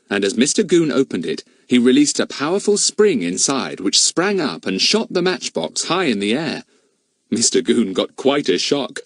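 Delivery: ordinary delivery, nothing marked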